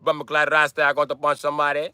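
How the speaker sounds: put-on Jamaican accent threat; mock Jamaican accent. Shown as a demonstration. Jamaican man threatening to punch somebody, mock Jamaican accent.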